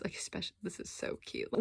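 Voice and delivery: breathy whisper of delight. Real, not acted. Gushing to viewers over a cute drawing, whispered. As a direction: whisper it like a secret crush.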